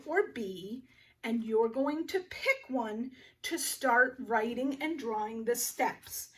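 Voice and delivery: normal talking voice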